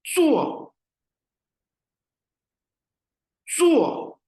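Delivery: चुओ said high and loud, clearly stressed; model pronunciation. Shown as a demonstration. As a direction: repeat चुओ high and crisp for learners. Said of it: "अगला word है जी चुओ".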